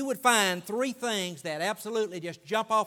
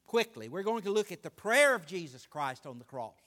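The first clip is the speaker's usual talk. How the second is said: ordinary talk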